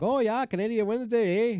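a friend at work playing up a Canadian accent; hammed-up Canadian accent. Joking friend on Canadian Wednesday, hammed-up Canadian accent. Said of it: "Everybody does Canadian accent".

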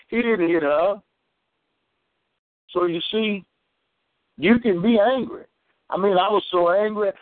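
normal talking voice

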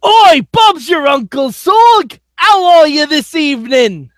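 loud, high hammed-up Cockney greeting; shouted mock-Cockney, drawn out; bad Cockney accent. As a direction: bellow it in a deliberately bad British accent. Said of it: "That was a British accent?".

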